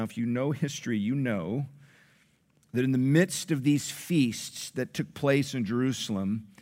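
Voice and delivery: ordinary talk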